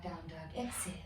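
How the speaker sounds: ordinary talk, nothing marked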